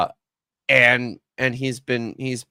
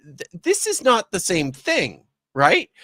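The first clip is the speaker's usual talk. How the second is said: higher-pitched, halting insistence; exasperated correction; 6 semitones higher than usual. Acted. Critic voicing everyone's reply to the detective, exasperated. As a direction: spell it out to someone missing the point.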